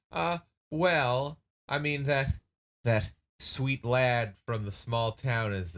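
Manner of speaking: sighing reluctance. Acted. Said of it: "sighs a little bit and goes".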